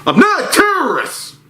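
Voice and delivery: high mock yell of a stranger; mock scream. Retelling a stranger screaming at a customer, high mock yell. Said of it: "I hear a loud scream".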